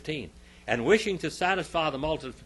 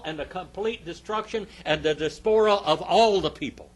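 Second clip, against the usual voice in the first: loud, raised pulpit delivery; forceful preaching; 7 semitones higher, 7 dB louder than usual. Acted. Preacher listing what Barabbas cost Israel, forceful preaching. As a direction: pile up the losses with force.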